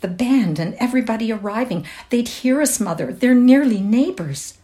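distressed appeal from a daughter; pleading. A narrator's reading. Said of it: "she pleaded".

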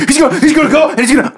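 high, rapid words through real laughter; giggly, high-pitched gabble; laughing while talking. Real, not acted. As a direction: rush it out while cracking up. Cracking up while describing the attack.

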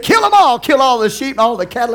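high, loud booming order; God's command. Retold or imitated. God orders Saul to kill everything, in a loud, high shout. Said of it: "God said".

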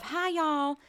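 high, sweet mock-Southern greeting; put-on Southern sweetness; charming Southern accent. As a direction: pitch it up into syrupy Southern charm. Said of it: "underneath that charm of".

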